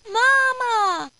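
high, loud doll voice; talking doll. A narrator's reading. Bread seems to call out to the policeman, talking doll voice. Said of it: "Again the bread says".

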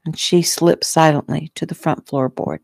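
ordinary delivery, nothing marked

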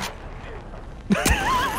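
high squealing burst of laughter; shrieking laugh. Real, not acted. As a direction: let out a startled squeal of laughter. Sudden shot, laughing scream.

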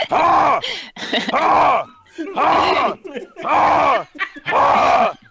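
loud, high, barked ha ha laugh; evil laugh. Acted. Cruel husband laughing at his crying wife, loud barks. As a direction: cackle at her cruelly, each ha punched.

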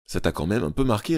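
plain reading voice

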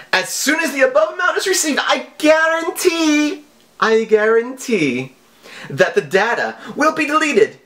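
high, theatrical villain read of the email; mock scammer voice. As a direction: read the scam letter like a hammy hacker. Reading scam email in mock voice.

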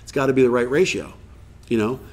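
usual speaking voice

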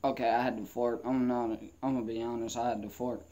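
usual speaking voice

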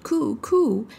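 high, cooed pigeon call; pigeon cooing. Acted. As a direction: coo it like a wood pigeon. Wood pigeon speaks.

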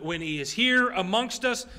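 ordinary talk, nothing marked

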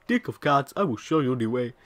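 exaggerated South African accent. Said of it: "In a very racist South African accent".

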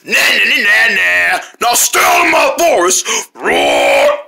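gloating bear jeer ending in a growl; jeer, then roar very low; taunting bear. Pretend. Bear warns turtles out of its forest, taunting.

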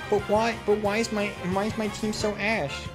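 high, plaintive mock complaint; mock whine. Streamer moaning about the team, mock whining. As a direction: whine it up high, held on one pitch.

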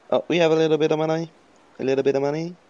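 high, loud, playful excitement; excited. Real, not acted. Player noticing some money to spend, excited. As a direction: pipe up high with glee.

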